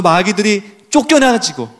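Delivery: ordinary talk, nothing marked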